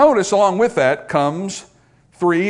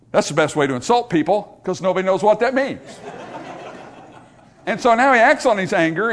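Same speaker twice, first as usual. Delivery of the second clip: ordinary delivery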